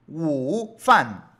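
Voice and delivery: long dipping-rising WU, then loud "fan"; disjointed "wu, fan"; full tones, unchanged. Shown as a demonstration. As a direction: overdo the whole dip on "wu" before "fan". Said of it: "algo no está bien ahí".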